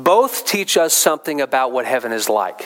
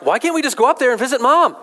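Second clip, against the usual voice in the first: put-on high, quick, eager little-kid question; four-year-old girl; 10 semitones higher, 3 dB louder, faster than usual. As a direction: imitate a small child piping up in church. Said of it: "She's like".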